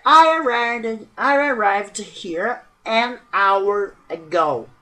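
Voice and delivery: frase em inglês lida bem devagar e articulada; leitura lenta de exemplo. Shown as a demonstration. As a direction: leia o exemplo pausadamente, como professora. (professora lendo o exemplo em inglês bem devagar)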